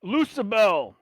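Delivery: loud, high and slow; calling to barkeep. Acted. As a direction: call across the bar. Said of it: "he goes".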